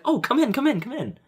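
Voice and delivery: high, repeated invitation; welcoming Japanese lady. Retold or imitated. Podcaster mimicking a hostess to a stranger, eagerly welcoming. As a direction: imitate an eager hostess ushering someone inside. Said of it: "Japanese lady is just like".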